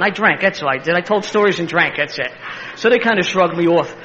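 ordinary talk

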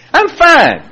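high-pitched mock denial; comically defensive; insistent denial. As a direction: insist on it, voice jumping up. Insisting he is not angry.

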